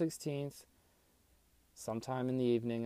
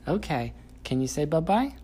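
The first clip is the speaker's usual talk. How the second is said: put-on higher, louder sing-song to toddler; reading to a toddler; 6 semitones higher, 8 dB louder than usual. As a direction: coax a toddler with praise. Teaching toddler animal sounds.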